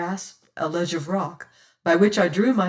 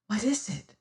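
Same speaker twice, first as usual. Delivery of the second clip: whisper; 3 semitones higher, 5 dB quieter than usual. Acted. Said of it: "she whispered".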